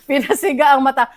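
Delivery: usual speaking voice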